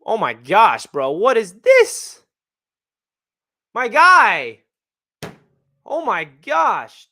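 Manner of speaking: loud, stunned disbelief at the donation; oh-my-gosh shock, drawn out slow. Real, not acted. Shocked by huge super chat.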